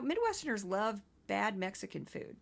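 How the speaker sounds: usual speaking voice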